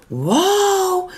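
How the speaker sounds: high, thrilled wow; excited wow. Acted. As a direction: gasp it out with delight. Egel spots the tunnel.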